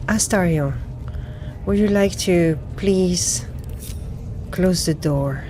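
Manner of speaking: ordinary delivery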